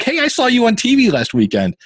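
high, bright mock greeting from the dentist; excited greeting. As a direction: imitate the dentist's eager hello, pitched high. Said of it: "They're like".

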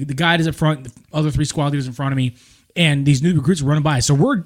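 ordinary talk

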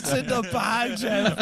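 high-pitched mock heckle; teasing heckle. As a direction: call it out high, through laughter. Teasing shout retold amid laughter.

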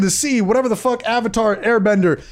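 ordinary delivery

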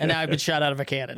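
jittery fast caffeine rush; too much caffeine. Real, not acted. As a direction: rattle it off, buzzing on coffee. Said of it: "I had five cups of coffee today".

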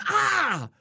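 high startled cry of pain, hammed-up; retold shriek of shock, loud and high; sudden yelp. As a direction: yelp like you've just been stung. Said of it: "all of a sudden he goes".

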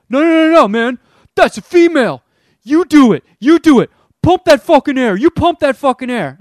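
very loud, high, furious screaming; retold screaming. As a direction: scream it in his face, losing it completely. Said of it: "he starts screaming at me even more".

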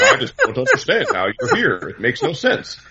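high, laughing mock confusion; baffled Thanos. Thanos baffled the heroes showed up, mock-confused and laughing. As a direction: play Thanos as comically clueless, laughing through it. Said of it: "the whole time he's like".

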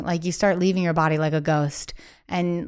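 usual speaking voice

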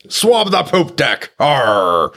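high, drawn-out mock pirate order; pirate shout. Morning chores on the ship, hammed-up pirate call. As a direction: play a pirate calling orders to the deckhands.

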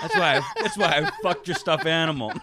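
loud, high, hammed-up taunting; mock trash talk. Fighter talking shit to Calvin, loud mock taunt. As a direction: shout it as a comic boast.

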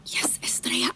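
hushed urgent whisper. Acted. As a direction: whisper so nobody hears. Sneaking out, hushing the maid.

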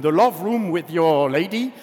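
ordinary talk, nothing marked

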